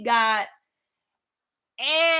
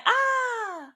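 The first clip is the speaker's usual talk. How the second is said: mock dismayed cry; 12 semitones higher than usual. Mock cry at missing mark.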